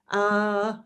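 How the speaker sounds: high, sustained "ah" held level; voice exercise "ah". Shown as a demonstration. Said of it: "he had me doing all these exercises".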